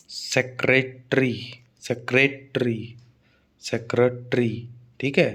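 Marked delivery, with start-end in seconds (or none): 0.1-1.3 "सेक्रेटरी" slowly, as से, क्रे, टरी; syllable by syllable. Shown as a demonstration. Teacher showing students three syllables, "सेक्रेटरी" slow and measured. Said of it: "तीन syllable". 1.8-4.7 "सेक्रेटरी" slowly, as से, क्रे, टरी; low, measured "सेक्रेटरी" with every syllable apart. Shown as a demonstration. Teacher repeating for students, "सेक्रेटरी" slow and measured. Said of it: "तीन syllable".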